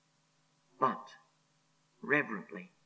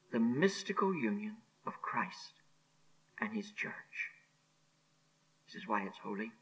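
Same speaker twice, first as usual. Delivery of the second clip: soft, low, awed whisper; reverent whisper; 6 semitones lower, 10 dB quieter than usual. Real, not acted. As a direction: lower into a hushed reverence. Preacher drops to reverent whisper.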